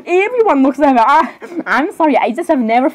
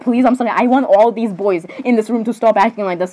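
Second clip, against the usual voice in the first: normal talking voice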